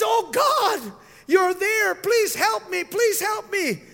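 high, loud relieved begging; desperate plea. Retold or imitated. Man on the branch begs God to save him, loud high plea.

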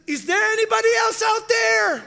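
high, loud comic yell; punchline shout. Retold or imitated. Man rejects God's answer and yells for someone else, loud comic shout. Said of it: "He says".

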